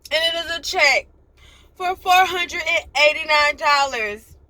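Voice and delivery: overwhelmed joy breaking through tears; tearful excitement. Real, not acted. To viewers about a surprise check, tearfully excited. Sudden emotional good news.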